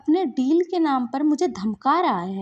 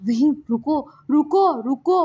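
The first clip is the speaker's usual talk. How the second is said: louder, urgent cry to stop; scared calling out; 4 semitones higher, 6 dB louder than usual. Acted. Lansan begs Wing to stop the beating, scared. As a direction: call out in alarm, mildly acted. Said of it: "हमारा लहसन ना डर जाता है".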